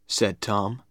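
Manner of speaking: usual speaking voice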